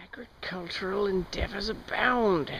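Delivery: hushed, breathy nature-documentary whisper. Acted. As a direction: whisper it like a wildlife presenter. Hushed mock-documentary aside on trail.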